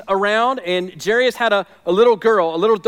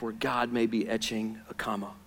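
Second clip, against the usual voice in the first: hushed, urgent whisper; 10 semitones lower, 13 dB quieter than usual. Real, not acted. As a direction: drop to a whisper on etching a comma. Intimate closing appeal to listeners.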